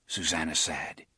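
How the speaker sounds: ordinary delivery, nothing marked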